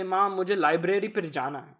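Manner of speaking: "library" with a Desi accent inside Urdu. Shown as a demonstration. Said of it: "That's correct".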